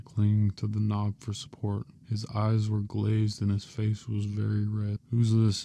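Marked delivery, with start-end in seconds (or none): none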